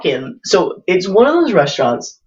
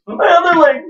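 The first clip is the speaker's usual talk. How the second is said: mock wail; 12 semitones higher than usual. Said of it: "That's an inside joke".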